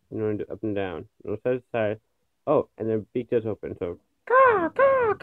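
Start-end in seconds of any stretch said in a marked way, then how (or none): 4.3-5.2 very high squawking caw; shrill screeching bird. Pretend. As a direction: screech like a crow. Beak opens, bird call follows.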